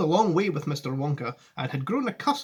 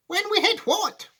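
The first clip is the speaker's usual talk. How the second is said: very high startled question; alarmed cry. A narrator's reading. Passengers questioning Wonka, high alarmed cry. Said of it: "they cried".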